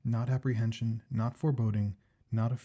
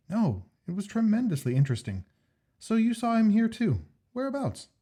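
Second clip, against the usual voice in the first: higher, eager Jack; Jack's voice; 11 semitones higher, 5 dB louder than usual. Acted. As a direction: voice the keen friend Jack, pitched up. Jack's voice in story.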